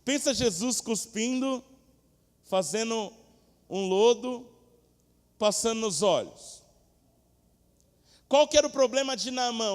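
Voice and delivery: loud, high preaching, slow in places; fiery preaching. Acted. Preacher stirring the congregation, loud fiery preaching. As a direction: preach it out to the church.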